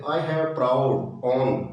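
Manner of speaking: I have proud on read very slowly; slow dictation. Shown as a demonstration. Teacher dictating exercise sentence to students. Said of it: "एक example और देख लेते हैं".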